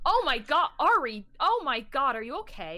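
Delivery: high, loud, worried shout; alarmed call-out. Acted. Leon calls to Ari to ask if they're okay, alarmed shout. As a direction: cry out in alarm for a friend. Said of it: "Leon calls out".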